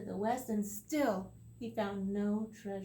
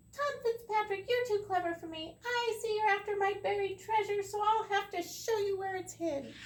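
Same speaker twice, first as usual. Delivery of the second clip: high, louder tiny leprechaun voice; 11 semitones higher, 5 dB louder than usual. Acted. The leprechaun admits Tom is too clever, high voice. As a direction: give in grudgingly as the wee man.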